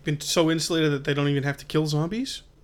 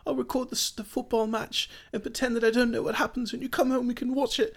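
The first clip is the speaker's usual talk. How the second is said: voicing the young son; 8 semitones higher than usual. Man voices the son leaving his dad a message, childlike and higher. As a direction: play the hopeful little boy on the phone. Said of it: "when his son is saying".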